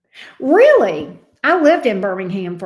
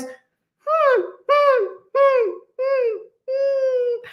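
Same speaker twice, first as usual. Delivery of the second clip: high, whimpering hums like a pleading pup; imitated dog whine; much slower than usual. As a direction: whine like a big dog begging to play. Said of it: "He goes".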